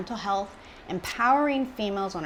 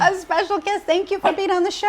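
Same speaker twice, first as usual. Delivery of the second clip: put-on baby talk to puppy; 11 semitones higher, 4 dB louder than usual. Host talks to the puppy.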